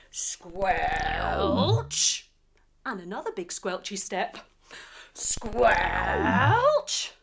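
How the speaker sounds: usual speaking voice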